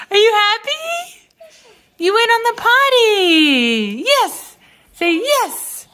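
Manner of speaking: potty training praise. Real, not acted. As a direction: coo proudly at a little one. Mom praising toddler on potty.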